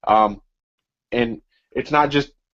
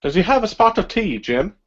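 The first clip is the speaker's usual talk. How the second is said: a hammed-up British accent, pitched higher; mock British accent; 7 semitones higher than usual. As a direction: put on a bad posh British accent for a joke. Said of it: "That was an awful British accent".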